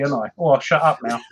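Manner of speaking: ordinary talk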